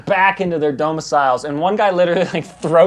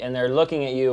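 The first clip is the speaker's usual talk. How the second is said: ordinary delivery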